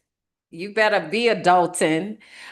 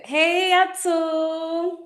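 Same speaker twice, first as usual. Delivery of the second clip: drawn-out high greeting; 11 semitones higher, much slower than usual. Real, not acted. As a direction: sing the name out high and slow. Greeting a chat viewer, high drawn.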